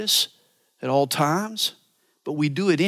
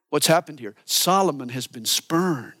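normal talking voice

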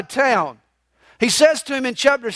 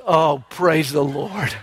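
slow, lower, laughing relief; relieved sigh; 6 semitones lower than usual. Retold or imitated. Rider saved at the cliff edge, relieved and laughing. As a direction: breathe it out in relief. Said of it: "he breathes a sigh of relief".

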